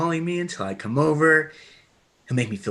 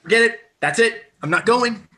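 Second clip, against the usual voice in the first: fed-up shout; 6 semitones higher, 4 dB louder than usual. Acted. Cameron fed up, quitting.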